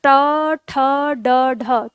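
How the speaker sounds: ट, ठ, ड, ढ sounded one by one with curled tongue; ट, ठ, ड, ढ recited separately, tongue touching the roof; retroflex consonants. Shown as a demonstration. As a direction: demonstrate ट, ठ, ड, ढ as separate retroflex syllables. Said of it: "जैसे आप बोलते हैं".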